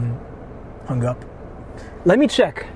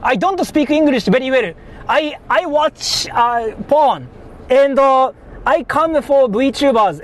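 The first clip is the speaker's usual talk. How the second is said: higher, halting mock Japanese accent with broken English; 5 semitones higher, slower than usual. Streamer pranking scam callers in a mock Japanese accent. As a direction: play a Japanese caller who barely speaks English. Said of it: "And I'm Japanese too, so I can just say something like".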